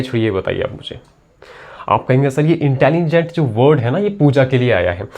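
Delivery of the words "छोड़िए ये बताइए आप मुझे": whisper. Real, not acted. Teacher aside to class.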